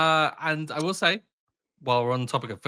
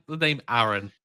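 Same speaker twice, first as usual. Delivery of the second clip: ordinary talk, nothing marked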